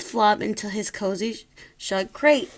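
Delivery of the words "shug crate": plain reading voice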